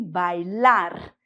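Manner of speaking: "bailar" with the last R trilled; Spanish "bailar", final R rolled firmly; strong final R. Shown as a demonstration. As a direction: sound out the R ending "bailar". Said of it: "com força".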